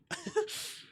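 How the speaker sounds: sudden high squeal of a laugh; high burst of laughter. Real, not acted. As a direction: laugh it off in disbelief. Reacts to character.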